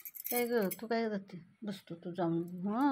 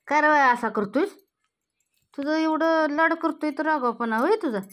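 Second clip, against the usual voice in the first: much louder, higher exasperated telling-off; raised voice scolding the kitten; 6 semitones higher, 8 dB louder than usual. Real, not acted. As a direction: yell at the naughty kitten.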